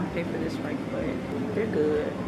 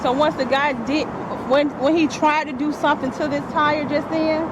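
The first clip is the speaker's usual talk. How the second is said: upset; 7 semitones higher, 4 dB louder than usual. Real, not acted. Upset customer explaining unsafe car.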